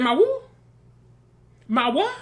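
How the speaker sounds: high-pitched imitated disbelief; mock incredulity. Carrie reacting to hearing of a wife, mock incredulous. Acting out Carrie's shocked reply.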